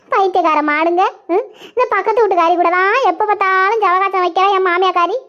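usual speaking voice

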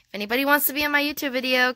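usual speaking voice

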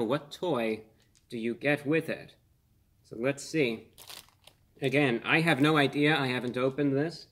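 ordinary delivery

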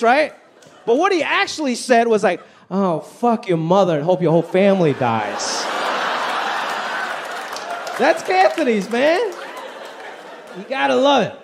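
ordinary talk

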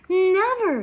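high, loud, stretched exclamation; astonished storybook mouse; awed gasp. A narrator's reading. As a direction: let the word burst out in amazement. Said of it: "gasped Annie".

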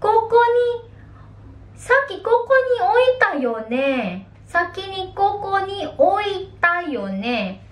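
high, alarmed voice looking for a lost wallet; worried, searching. Acted. Someone missing their wallet, high and alarmed. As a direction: sound puzzled and a bit panicked. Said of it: "Sabi mo".

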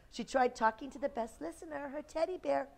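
ordinary delivery, nothing marked

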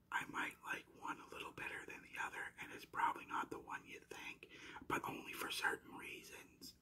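hushed, secretive preview whisper; whispered teaser. Pretend. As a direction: leak a spoiler under your breath.

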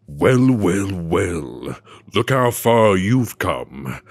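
slow southern villain drawl; scary southern outlaw devil, drawing the words out. Acted. Devil boss tempting the player to join him, slow southern villain. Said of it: "I don't know why I gave him, like, a southern accent".

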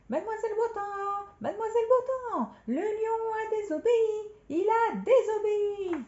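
high, loud tattletale cry; trumpeting. Acted. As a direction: trumpet the news down the corridor. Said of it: "Il était trop occupé à claironner".

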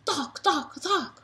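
high, loud popping thunks; repeated thunks. Acted. Reader voicing rapid racket hits, loud thunks. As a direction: bounce out each hit.

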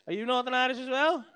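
ordinary talk, nothing marked

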